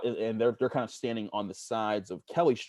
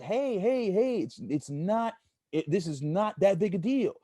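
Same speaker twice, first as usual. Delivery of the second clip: higher, a bit slow plea to calm down; retold pleading; 10 semitones higher, slower than usual. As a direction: hands up, begging them to ease off. Hands up, pleading with police.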